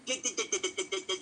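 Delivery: tongue tripping over a rapid-fire "get"; stuttering from talking too fast. Real, not acted. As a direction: stammer one word like a stuck record. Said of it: "I tend to speak a bit too fast".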